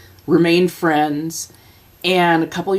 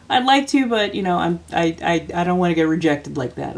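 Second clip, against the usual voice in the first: ordinary talk, nothing marked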